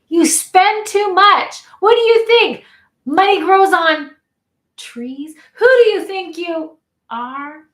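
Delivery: loud, high, scolding parent; arguing parents. Acted. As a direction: act out an angry money quarrel. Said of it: "hear your parents arguing about money".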